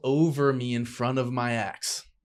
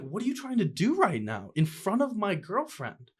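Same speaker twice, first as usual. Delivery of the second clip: retold irritation; 6 semitones higher than usual. Confronting the girl in front of the girlfriend, mild irritation. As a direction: act out indignant questioning, kept contained. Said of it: "getting pissed 'cause I'm like".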